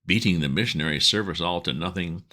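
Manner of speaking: ordinary delivery, nothing marked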